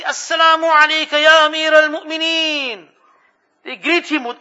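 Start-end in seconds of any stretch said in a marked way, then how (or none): 0.0-2.9 loud, high ceremonial salute; formal greeting called out. Retold or imitated. Companions greeting the caliph, called out loud and high. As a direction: hail the leader across the room.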